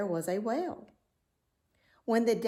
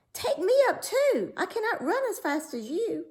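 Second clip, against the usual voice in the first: very high, louder plea; pleading frog; 12 semitones higher, 5 dB louder than usual. A narrator's reading. Frog begging the running princess, high loud plea. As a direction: call after her, begging. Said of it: "cried the frog".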